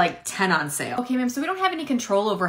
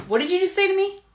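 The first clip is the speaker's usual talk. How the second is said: high, stunned disbelief; outraged clerk snapping back; shocked; 12 semitones higher than usual. Acted. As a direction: recoil at the insult, pitched way up. Clerk shocked by rude customer.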